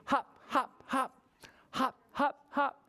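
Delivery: short, high, separate hops; bouncy hopping. Acted. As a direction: bounce each word like a hopping rabbit. Acts out rabbits hopping.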